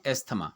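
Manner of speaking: asthma with t-h sounded, est-thma; common pronunciation. Shown as a demonstration. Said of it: "pronounce करते हैं?".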